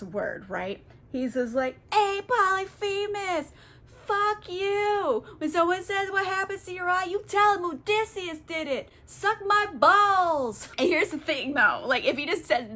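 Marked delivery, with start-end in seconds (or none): 1.9-10.7 high, cocky taunting voice, dragged out; mocking taunt. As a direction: jeer at the Cyclops like a brat. Odysseus taunts the Cyclops.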